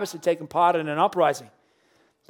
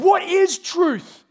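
much higher, louder, very slow sneer; incredulous scoff; 12 semitones higher, 7 dB louder, much slower than usual. Acted. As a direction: stretch it out in disbelief. Pilate's dismissive famous question.

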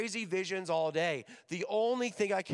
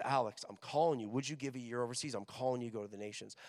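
softer, lower, gentle call; God's voice; 6 semitones lower, 6 dB quieter, faster than usual. Retold or imitated. God calling Alex overseas, softer, lower gentle voice. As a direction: speak softly like a voice in the heart.

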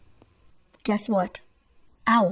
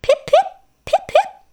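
very high, fast, loud peeps; peeping robin; 4 dB louder, faster than usual. Acted. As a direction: peep quickly like a little robin. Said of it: "And the robins peeped".

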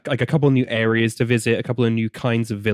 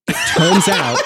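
laughing; 5 semitones higher, 4 dB louder than usual. Real, not acted. Laughing retelling.

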